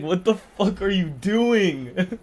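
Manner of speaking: slow, high, loud imitation of a shocked comic; slow, high mock outrage; mock indignant reply. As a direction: act out a stunned comic snapping back. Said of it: "it's like".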